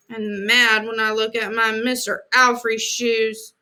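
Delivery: ordinary delivery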